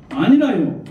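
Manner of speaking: rising-falling Gyeongsang grannies' plea, drawn out at the end; Gyeongsang dialect. Retold or imitated. Grandmothers begging God to appear, pleading in Gyeongsang dialect. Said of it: "경상도 말씀으로".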